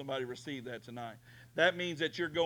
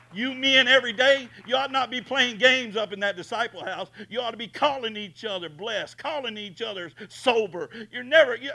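booming pulpit shout; full-force preaching; 7 semitones higher, 12 dB louder than usual. Acted. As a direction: preach it hard at the men.

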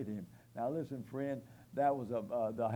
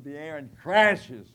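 slow, loud preaching peak; loud slow crash; 15 dB louder, slower than usual. Real, not acted. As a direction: let it crash down, slowly. Dramatizing the Roman fist striking.

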